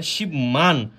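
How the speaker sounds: শিবমান split into "shib" and "man"; mistaken pronunciation. Shown as a demonstration. Teacher showing what is completely wrong, unassimilated শিবমান. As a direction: keep the b in শিবমান, each syllable separate. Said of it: "সেটা সম্পূর্ণরূপে ভুল".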